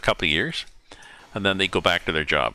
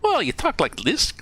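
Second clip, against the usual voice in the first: much higher, playful imitation of a Russian accent; mock Russian accent; 13 semitones higher than usual. Retelling Rachel's joke, mock Russian accent. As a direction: put on a bad Russian accent. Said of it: "talk to them like Minsky".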